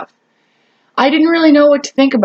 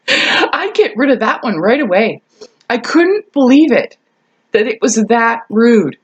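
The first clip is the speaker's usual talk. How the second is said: ordinary talk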